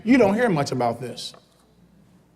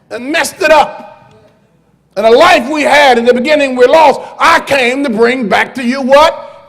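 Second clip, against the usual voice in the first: loud, higher, fervent pulpit preaching; preaching at full force; 5 semitones higher, 7 dB louder than usual. Acted. Pastor thundering at his church about violence and life, full-force preaching. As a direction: rise and hammer each line to the congregation.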